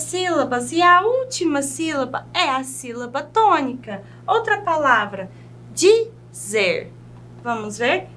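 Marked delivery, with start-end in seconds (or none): 5.7-6.9 "dizer" split into di, zer; syllable by syllable. Shown as a demonstration. Teacher showing the strong last syllable, "dizer" split in two. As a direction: say "dizer" lower, with a gap between syllables. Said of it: "é mais forte".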